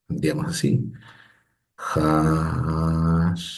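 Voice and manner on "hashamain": "Hashamain" drawn out very slowly, syllable by syllable; slow careful reading. Shown as a demonstration. Teacher reads the word very slowly so the students can follow. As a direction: stretch "Hashamain" out slowly for the class. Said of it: "Acá tenemos que Hashamain, así".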